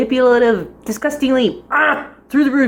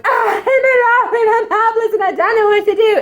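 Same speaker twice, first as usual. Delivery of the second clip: high, hammed-up helpless whining; mock lovesick wail; 10 semitones higher than usual. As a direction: wail like a swooning melodrama heroine, in parody. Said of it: "But it's not like".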